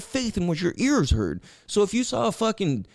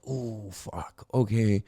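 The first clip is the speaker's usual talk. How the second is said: much lower and softer, a defeated sigh of words; resigned giving in; 10 semitones lower, 6 dB quieter, much slower than usual. Retold or imitated. Himself conceding to the inner voice, soft and resigned. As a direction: give in quietly, beaten.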